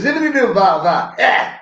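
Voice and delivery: high mock soul scat. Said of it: "You sound like [name]".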